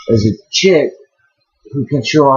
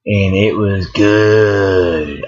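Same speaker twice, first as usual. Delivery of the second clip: drawn-out savoring; 2 semitones lower, much slower than usual. Real, not acted. Savoring the drink.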